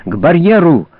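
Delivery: high, loud, gasping challenge; breathless shout. A narrator's reading. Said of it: "задыхаясь и кашляя, крикнул Шрам".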